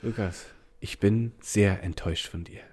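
low, soft disappointed voice; quiet, measured disappointment; calm disappointed father. Retold or imitated. Father telling his son he is disappointed, low and quiet. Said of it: "Und dann er nur so einfach so".

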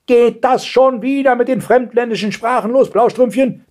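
high-pitched angry scolding; thundering grandmother. A narrator's reading. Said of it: "donnerte die Großmutter". (wetternde Großmutter, hohe Stimme)

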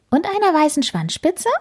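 eager kitten checking a detail; kitten asking. A narrator's reading. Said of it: "fragt Karlchen". (neugierige, hohe Frage)

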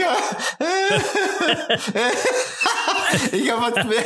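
high giggling through the words; laughing. Real, not acted. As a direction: laugh helplessly. Giggling can't continue.

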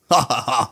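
low chuckle. Acted. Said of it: "Lacht der Typ".

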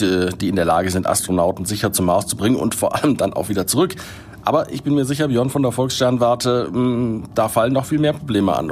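normal talking voice

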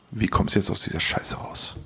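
hushed, as if thinking it to himself; whispered thought. Retold or imitated. Telling a friend his panicked thought, whispered. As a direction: breathe it out under your breath. Inner thought on a bad date.

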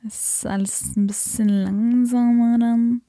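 slow, drowsy mock delivery. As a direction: drag the words out like you're sedated. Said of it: "Dann ist das ein bisschen slower".

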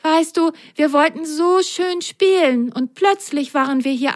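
sweetly coaxing a grumpy machine; sweet and gentle. A narrator's reading. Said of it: "sprach ganz lieb mit der Puppenwaschmaschine".

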